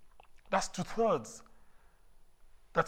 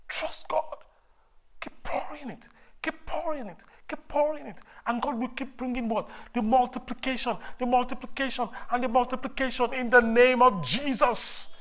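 high fervent exhortation; preaching at full force; 8 semitones higher, 4 dB louder than usual. Acted. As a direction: lift the crowd, higher.

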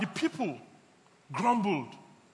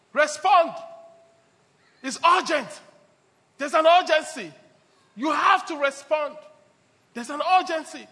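preaching; 5 semitones higher, 8 dB louder than usual. Acted. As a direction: proclaim it to the congregation. Preaching climax on urgency.

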